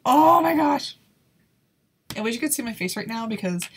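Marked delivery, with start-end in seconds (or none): 0.0-1.0 loud, higher burst of alarm; startled exclamation. Real, not acted. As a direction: cry it out in shock. Reacting to hard course.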